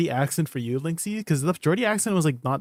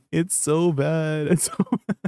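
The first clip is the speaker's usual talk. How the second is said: words breaking into laughter; helpless laugh at how awful it is; real laughing. Real, not acted. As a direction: crack up while saying it, slowing down. Cracks up at awful accent.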